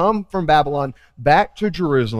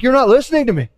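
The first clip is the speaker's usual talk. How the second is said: God's frustrated voice; 7 semitones higher than usual. Retold or imitated. God rebuking his people for empty words, pleading. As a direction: press the hurt of being ignored. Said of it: "he's like".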